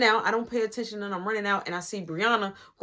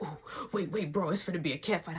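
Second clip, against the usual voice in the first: soft, low retold warning; imitated under-the-breath heads-up; hushed aside; 6 semitones lower, 10 dB quieter, faster than usual. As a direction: murmur it to a buddy on the side. Friend's hushed aside about fight.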